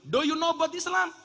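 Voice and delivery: high, loud quizzing of a foreigner; insistent questioning. Retold or imitated. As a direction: press the question, pitched right up. Questioning a would-be convert. (bertanya mendesak dengan nada tinggi)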